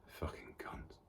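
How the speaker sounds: whispered curse under the breath; barely audible, bitter aside. Acted. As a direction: spit the insult out in a whisper. Bitter aside about his parents.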